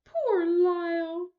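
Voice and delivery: slow, sighing pity. Acted. Missus Prim sighing over frightened Lyle at night. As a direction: sigh it out with pity. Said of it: "sighed Missus Prim".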